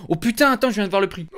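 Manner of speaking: high-pitched startled reaction; sudden sticker shock. Real, not acted. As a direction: blurt it out high, stunned by the fare. Reacts to seeing the Uber price.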